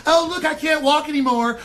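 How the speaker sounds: high, whiny mock voice; mocking parody, pitched high; mock fragile trendy. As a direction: play a helpless trendy person whining. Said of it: "and go".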